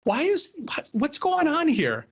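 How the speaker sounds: high, baffled, half laughing; a worker, amused, asks his yelling boss what is going on; baffled disbelief. Retold or imitated. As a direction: ask why he's yelling, amused and baffled. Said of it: "I was chuckling".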